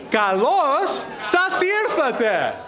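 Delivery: high, slow call of "Kalos sas irthate" to the crowd; calling out greeting. Real, not acted. Best man calling the Greek greeting to a hundred and sixty guests, called out high and slow. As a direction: sing it out to the whole room.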